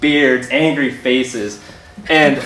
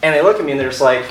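ordinary delivery

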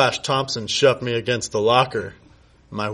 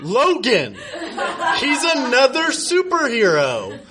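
much higher, laughing coo; mock tender coo; 10 semitones higher than usual. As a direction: coo it like a smug lover. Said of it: "cooed Kyle".

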